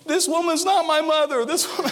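put-on high pitched kid yelling in a crowd, half laughing; a hammed-up mall yell from a naughty kid; mock child yell. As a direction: replay a child shouting he's been kidnapped. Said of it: "I was yelling".

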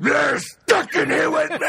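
high, hammed-up movie yell; mock menacing shout. Finishing friend's Watchmen quote, hammed-up roar. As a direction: belt out the punchline as a threat. Acting out famous movie threat.

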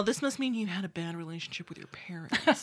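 mock whispered therapist line; hushed, breathy aside.

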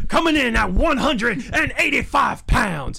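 high, booming fight intro; ring announcer shout. Acted. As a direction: heroic rallying shout.

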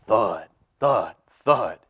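heavy stomping sound effect; giant footsteps. A narrator's reading. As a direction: stomp it out slowly.

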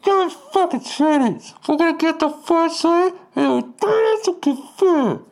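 very high, distorted megaphone rant; girl on megaphone. Retold or imitated. Protest leader rallying the crowd over a megaphone, megaphone squawk. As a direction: mimic an angry protester blaring through a megaphone. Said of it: "then the girl's like".

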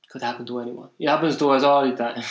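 badly imitated Italian accent; hammy Italian-accented shrug it off; terrible Italian accent. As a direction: reassure in broken Italian-accented English. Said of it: "I'm doing a terrible Italian accent".

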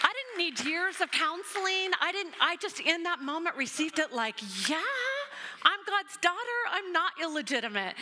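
joyful release. Real, not acted. Joyful realization she's God's daughter.

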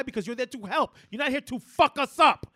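lightly voiced Pacino, building to a shout; mild mock Al Pacino tirade; Pacino impression. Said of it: "Glengarry Glen Ross impression".

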